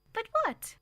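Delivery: put-on high, wondering child's question; girl asking her mother what he's up to; puzzled child. A narrator's reading. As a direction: ask it wide-eyed.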